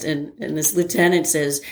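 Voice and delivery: ordinary talk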